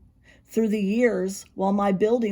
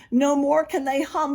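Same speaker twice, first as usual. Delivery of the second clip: plain reading voice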